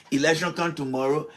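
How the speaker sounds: usual speaking voice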